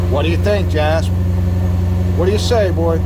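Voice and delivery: high, loud pet talk; cheerful call to the dog. Real, not acted. Talking to his dog Jasper.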